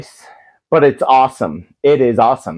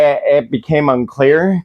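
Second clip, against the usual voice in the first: ordinary delivery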